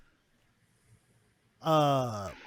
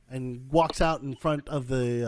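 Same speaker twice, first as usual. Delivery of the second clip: ordinary talk, nothing marked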